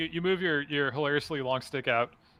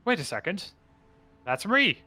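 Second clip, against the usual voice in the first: higher-pitched, bewildered voice; startled mysterious figure; 7 semitones higher than usual. Acted. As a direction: realise with surprise it's you. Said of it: "the figure says".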